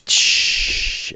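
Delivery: very soft, drawn-out shh; conspiratorial shushing. Acted. Dwarves hush the hero about the rumor, conspiratorial shushing. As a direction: hush them secretively.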